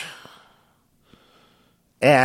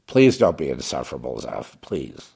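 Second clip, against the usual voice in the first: ordinary talk, nothing marked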